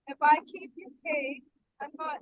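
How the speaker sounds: muffled, drowned-out voice; like talking from under water; underwater. Real, not acted. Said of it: "You sound like you underwater".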